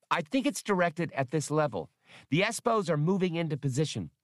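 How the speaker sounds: high, piping little computer; Max, eager. Acted. Small computer reports a security alert, eager and high-pitched. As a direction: chatter excitedly like a childlike machine.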